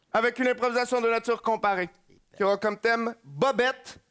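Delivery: usual speaking voice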